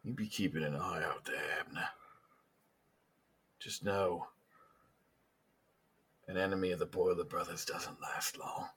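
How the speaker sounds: hushed menacing gangster voice, near a whisper; quiet threat. Acted. Gang leader warns Abner to watch out, hushed and menacing. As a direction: keep it very soft, a veiled warning.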